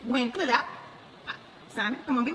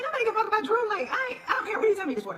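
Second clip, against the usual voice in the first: dismissive retort; 9 semitones higher than usual. Retold or imitated.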